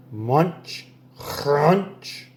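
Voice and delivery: ordinary delivery, nothing marked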